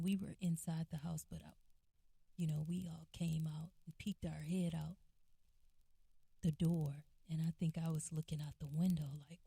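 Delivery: ordinary talk, nothing marked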